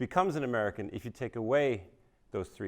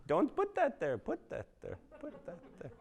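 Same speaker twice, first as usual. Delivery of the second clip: high voice fading to a breathy laugh; high scold that trails off softer, laughing; ex-wife's order dissolving into a soft giggle; mimicking the ex-wife; 11 semitones higher, 5 dB quieter than usual. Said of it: "She'd be like".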